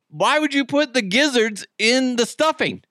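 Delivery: incredulous protest. Retold or imitated. Guest appalled by gizzards in the stuffing, incredulous protest. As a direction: recount it as a high-pitched, appalled question. Retelling his disgusted protest.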